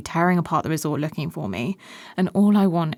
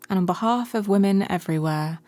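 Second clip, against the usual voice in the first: ordinary talk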